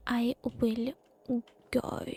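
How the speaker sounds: ordinary talk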